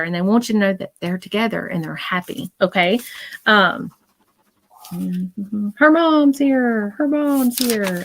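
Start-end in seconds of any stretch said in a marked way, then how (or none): 5.8-8.0 high, slow, drawn-out chant; echoed insistent call. Retold or imitated. Medium echoes a repeated message, drawing it out. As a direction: stretch it out high like a distant call. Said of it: "I keep hearing it over and over and over".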